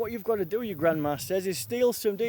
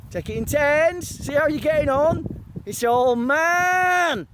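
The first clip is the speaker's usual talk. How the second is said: higher, slow put-on macho drawl on man; mock macho voice; 5 semitones higher, slower than usual. Man in the shower line boasting, slow put-on macho voice. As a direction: boom it like a locker-room boss.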